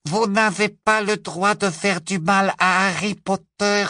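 high squeaky house-elf voice; high little squeaky elf; squeaking elf. A narrator's reading. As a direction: squeak out a brave defiance. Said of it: "couina-t-il".

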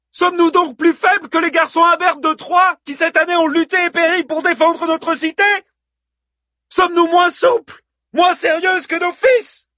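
very high, loud rallying speech; haranguing. A narrator's reading. Hippodamie stirs the women of Troy to fight, haranguing. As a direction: harangue a crowd, shouting every question. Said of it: "s'était mise à haranguer les femmes de Troie".

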